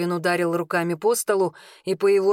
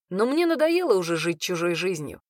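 ordinary delivery, nothing marked

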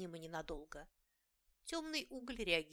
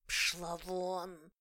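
hissed, louder, snarling cat; hissing; 5 dB louder than usual. Acted. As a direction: spit it out like an angry cat. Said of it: "зашипела".

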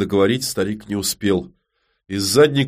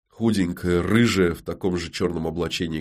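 usual speaking voice

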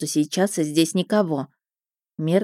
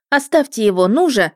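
raised, urgent demand; shouting; 5 semitones higher, 4 dB louder than usual. A narrator's reading. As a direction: order them off while running. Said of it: "крикнула я на бегу". (настойчивый окрик на бегу)

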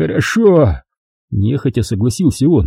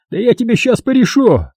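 mild mock yell; 12 semitones higher than usual. A narrator's reading. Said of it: "заорал Макс".

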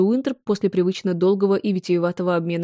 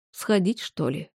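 softer, wistful inner thought; 5 dB quieter, slower than usual. Acted. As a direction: sigh it to yourself, glumly. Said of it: "тоскливо подумал Уинтроп".

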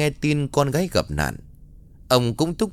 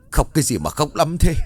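snapping; 6 semitones higher than usual. A narrator's reading. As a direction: scold her to stop crying. Parents quarrel at wake.

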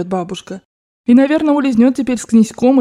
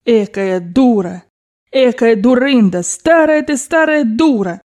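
slow, drawn-out cursing of herself; self-reproaching exclamations; 3 semitones higher, slower than usual. Acted. Grandmother cursing herself on the way back, exasperated exclamations. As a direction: burst out in rueful self-reproach. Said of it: "начались вырываться восклицания".

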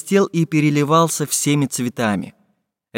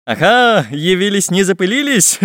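higher-pitched mocking greeting; amused teasing; 6 semitones higher, 4 dB louder than usual. A narrator's reading. Самоделкин greets the late pirates, amused teasing. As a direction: tease the latecomers with a chuckle. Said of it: "усмехнулся Самоделкин".

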